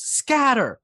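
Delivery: high, loud barked order; yelled command. Acted. A brawler ordering the crowd to flee, loud yell. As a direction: send the crowd running with one yell. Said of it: "and yells".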